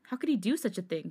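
half-gasp the swear in outrage; shocked outburst. A narrator's reading. Friend hearing of the cheating, shocked and outraged. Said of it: "Hyunjin gasped in shock".

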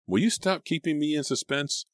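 high-pitched impatient outburst; exasperated shriek. A narrator's reading. Girl begging friend for the news, exasperated shriek. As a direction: demand it in a high squeal. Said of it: "Selina shrieked".

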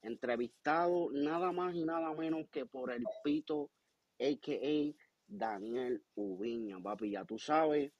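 big show-closing announcer. Acted. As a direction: sign off the show like a ring announcer. Host signs off show in Spanish.

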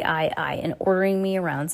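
usual speaking voice

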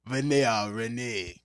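normal talking voice

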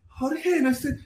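sweet soft Colombian woman; lightly voiced Colombian woman. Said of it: "no sé imitar la voz colombiana".

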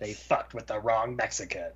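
low, hushed trailer announcer; movie trailer voice. Retold or imitated. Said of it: "the trailer voice guy from Machete Kills".

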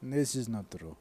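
deadpan mock Russian accent. Russian guys bragging to each other, in a Russian accent. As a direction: boast and bicker as Russian guys. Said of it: "completely lost with the Russian accent".

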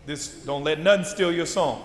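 normal talking voice

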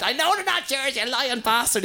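high-pitched outraged ma; mock accusing mother. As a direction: imitate mum calling out a liar, voice shooting up. Mother accuses dad of lying.

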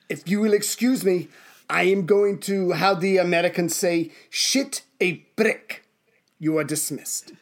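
measured mock-German restraint; comic dictator with halting German accent; acted Hitler. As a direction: hold in the tantrum, deliberately, then dismiss. Plays Hitler dismissing the officer.

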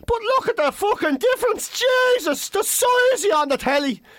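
very high, hammed-up disbelief; astonished stranger. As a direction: exclaim like a tactless fan, shocked. Tactless stranger astonished at weight loss.

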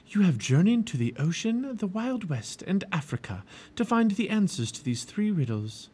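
enchantress Morgan. A narrator's reading. Narrator voices Morgan the enchantress.

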